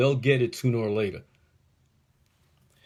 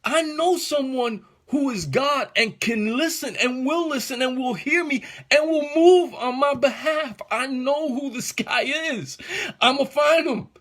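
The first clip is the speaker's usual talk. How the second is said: a desperate dad who suddenly knows who can help; voiced father's rising hope; father's inner hope; 11 semitones higher than usual. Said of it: "what you said inside yourself that said".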